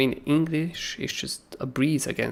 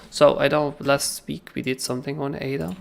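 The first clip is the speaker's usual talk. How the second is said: ordinary talk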